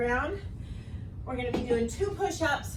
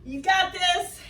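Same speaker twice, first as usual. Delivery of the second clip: pumped-up encouragement; 5 semitones higher, slower than usual. Real, not acted. Coach encouraging through hard set.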